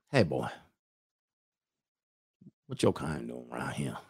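low, quiet, menacing Mississippi local; Mississippi accent. Acted. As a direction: confront an outsider softly in a low Mississippi voice. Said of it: "do the Mississippi accent".

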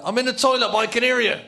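high, full-voiced yell; shouting back. Player stuck in the toilet answers the manager, shouting. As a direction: yell it through a closed door.

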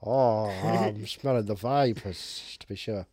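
slow, drawling southern belle voice; a hammed-up Deep South drawl; southern accent. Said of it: "I'm doing a southern accent here".

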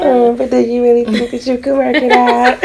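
loud mock sultry singing voice; put-on singer voice. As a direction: belt it like the singer. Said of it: "Everybody changed their voice so they can fit hers".

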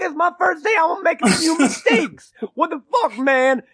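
high-pitched, shouting comic tirade; hammed-up indignant ranting, Pryor-style; Jesse. Fired friend protesting over the phone, escalating high mock outrage. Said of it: "he sounded like Richard Pryor".

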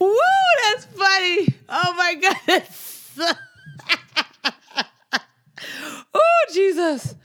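high, slow, breathless laughing words. Real, not acted. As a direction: wind down from a laughing fit. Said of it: "I was cracking up".

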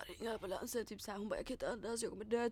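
soft, faint imitation of breathlessness; breathless. Friend fearing she will die, mock breathless plea. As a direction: plead weakly as if short of air. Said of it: "för hon kunde inte andas".

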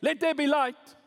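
playful grand pronouncement; mock divine command. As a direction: boom it out like a decree. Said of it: "and I say".

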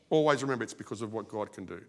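ordinary talk

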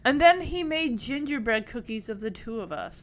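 usual speaking voice

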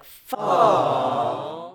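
exasperated curse. Retold or imitated. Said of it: "you're always kinda just like".

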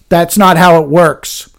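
clerk's stern refusal. Retold or imitated. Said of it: "he was, like, angry".

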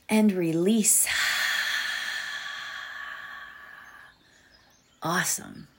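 soft whispered meditation guide; whispering. Acted. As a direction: breathe it out softly, close and hushed.

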